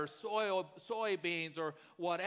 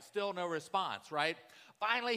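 normal talking voice

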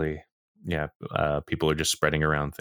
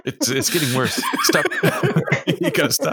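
laughing; 10 semitones higher, 5 dB louder than usual. Real, not acted. Laughing begs friend to stop.

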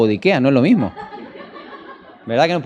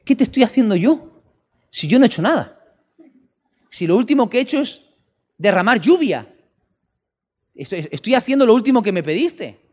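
higher-pitched, lightly puzzled protest; mildly indignant, raised-pitch innocence; bewildered God; 6 semitones higher than usual. Retold or imitated. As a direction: play a baffled God defending himself. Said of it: "Y el Señor dice".

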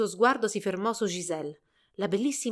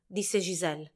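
ordinary delivery

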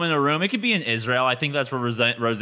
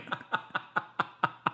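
breathy silent laugh; 10 dB quieter than usual. Real, not acted. Laughing at own joke.

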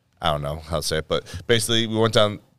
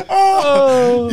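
laughing hard. Real, not acted. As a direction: crack up at the joke. Reacts to the chant.

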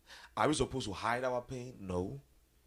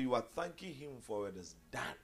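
ordinary delivery

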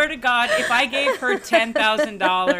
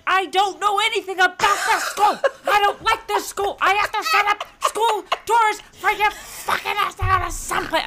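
inner angry mom; 11 semitones higher than usual. Retold or imitated. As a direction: vent like a mom at the end of her rope. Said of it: "I don't yell fucking".

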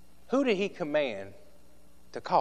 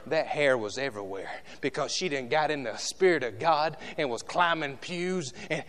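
ordinary delivery

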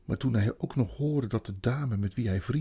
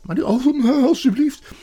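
high, loud whining plea; begging boy; 12 semitones higher, 10 dB louder than usual. Acted. Edmund begs the queen for marzipan, loud and pleading. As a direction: plead desperately, high and insistent.

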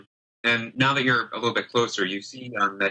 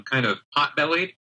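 ordinary talk

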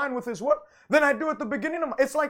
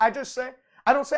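ordinary talk

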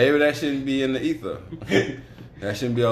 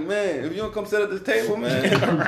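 mock lecturing; 8 semitones higher than usual. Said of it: "And I'm like".

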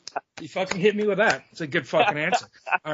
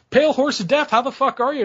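excited call-out; 8 semitones higher than usual. Real, not acted. Podcast host hyping up his late guest, excited. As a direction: hail him like a rowdy show host.

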